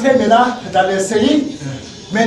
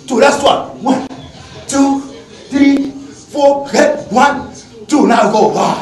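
fitness coach counting; 3 semitones higher, much slower than usual. Acted. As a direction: call out reps to the class.

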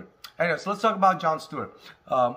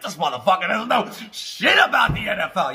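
mock angry fan; 5 semitones higher, 6 dB louder than usual. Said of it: "You're like".

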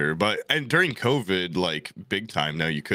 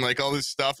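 usual speaking voice

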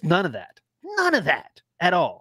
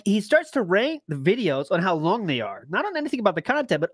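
ordinary talk, nothing marked

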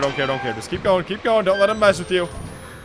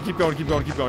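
ordinary talk

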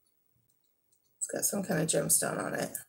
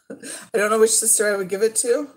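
laughing; 2 semitones higher, 7 dB louder than usual. Real, not acted. Laughing about choosing a sister.

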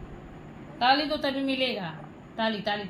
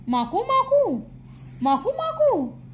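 playful high-pitched coaxing; 12 semitones higher than usual. Real, not acted. Mother baby-talks while feeding baby.

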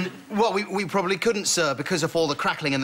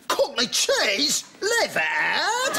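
slow, high mock disbelief; disbelieving Cockney man; 8 semitones higher, much slower than usual. Account director playing a Cockney finding cheese, high slow incredulous voice. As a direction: act a stunned Cockney, drawing each word up high. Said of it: "and he can't believe it".

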